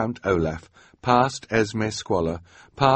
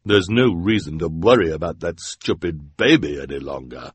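deep-voiced woman; 3 semitones lower than usual. A narrator's reading. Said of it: "in her deep, deep voice".

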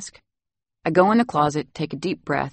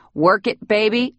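louder, higher cheering call; playful shout; 6 semitones higher, 4 dB louder than usual. Acted. Nanny cheering boy on his pretend runway, loud. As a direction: hype him up like a runway fan. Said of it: "I shout".

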